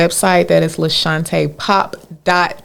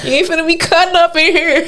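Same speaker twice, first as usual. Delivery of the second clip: 1 mock scolding with a laugh; 10 semitones higher, faster than usual. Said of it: "I was like".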